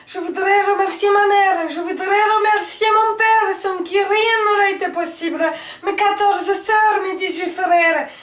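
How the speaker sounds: loud, high, overcome speech; tearful acceptance speech. Acted. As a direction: thank everyone, voice full of emotion. Emotional award acceptance speech parody.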